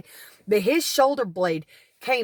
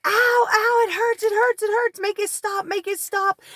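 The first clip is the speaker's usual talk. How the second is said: high-pitched imitation of a man yelling in pain; retold pained yell; 11 semitones higher, 4 dB louder than usual. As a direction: mimic his frantic pleading yell, loud at first then easing. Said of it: "And he's yelling".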